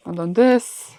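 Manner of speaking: ordinary delivery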